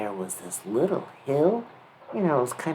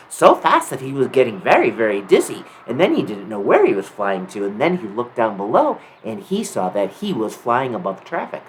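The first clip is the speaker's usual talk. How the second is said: ordinary delivery, nothing marked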